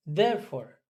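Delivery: ordinary delivery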